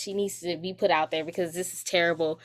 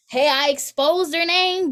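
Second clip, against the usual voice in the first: higher, louder, slower defiant declaration; 8 semitones higher, 6 dB louder than usual. Real, not acted. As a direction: own it, pitched up and loud. Defiant repeat of naming teacher.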